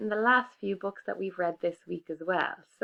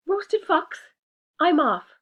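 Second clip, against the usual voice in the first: higher, louder panicked fox; scared fox; 11 semitones higher, 9 dB louder, slower than usual. Acted. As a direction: yelp in mock fright.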